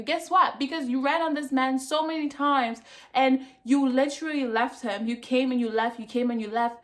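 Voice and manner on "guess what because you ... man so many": higher, louder, taunting; exasperated jab at Shay; worked-up challenge. Real, not acted. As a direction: throw it at her heatedly. Heated rant at Shay.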